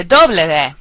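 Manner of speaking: doble V said "DOH-bleh veh"; Spanish letter name. Shown as a demonstration. Teacher giving another pronunciation of W, careful Spanish. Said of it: "you can say".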